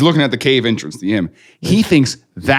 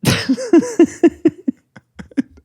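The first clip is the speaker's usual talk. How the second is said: high giggly laugh; 4 dB quieter than usual. Real, not acted. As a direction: talk through the giggles. Laughing at the joke.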